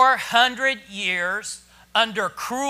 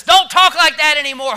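loud, raised telling-off; blunt rebuke; 6 semitones higher, 12 dB louder than usual. Acted. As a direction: scold them for their complaining. Blunt version of Moses' rebuke.

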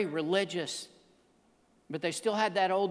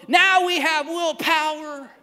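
slow, high, loud proclamation as Frog; triumphant declaration; 9 semitones higher, 8 dB louder, slower than usual. Acted. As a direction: announce victory with drawn-out emphasis. Frog's climactic declaration to Toad.

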